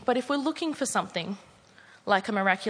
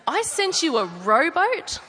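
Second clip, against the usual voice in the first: Aussie God; 7 semitones higher, 4 dB louder than usual. Acted. God answering the drowned man, exasperated, in an Australian accent. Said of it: "who's an Aussie in this version".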